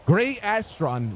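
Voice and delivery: gray Astron drawn out slowly, each word stretched; savouring gray Astron at a crawl; slow emphasis. Shown as a demonstration.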